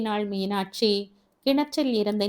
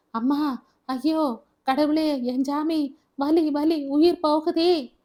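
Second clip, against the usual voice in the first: screaming in pain; 7 semitones higher, slower than usual. Acted. Arumugam with his foot severed, screaming in agony. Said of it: "கதறினான்".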